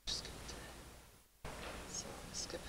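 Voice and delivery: under her breath, just for herself; soft muttered asides while skipping ahead; muttering to herself. Real, not acted. Skipping through video, talking to self.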